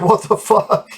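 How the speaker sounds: high squeaky incredulous laugh; laughing disbelief. Real, not acted. Laughing at the friend's immunity claim. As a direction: crack up in disbelief.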